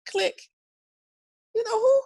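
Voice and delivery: high, incredulous squeak; can't believe the president called; disbelieving. Retold or imitated. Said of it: "I'm like".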